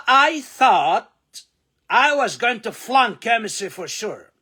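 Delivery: I thought I was going to flunk chemistry for sure, slow, high and loud; full model sentence. Shown as a demonstration. Teacher models the full line for students. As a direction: perform the whole sentence as a model. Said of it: "لما أقول لك كده".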